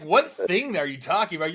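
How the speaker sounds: high-pitched teasing imitation; mock puzzlement. Host teasing guest about his forgotten project, mock-baffled. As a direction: play baffled, voice pushed way up.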